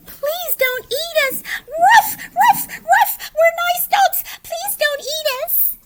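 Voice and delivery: high, loud, sobbing plea; crying dogs. Acted. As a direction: beg the monster not to eat you. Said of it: "cried the dogs".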